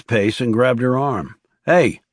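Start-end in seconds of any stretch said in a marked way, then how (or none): 1.6-2.0 insistent call. A narrator's reading. Man grabs her arm, demands attention.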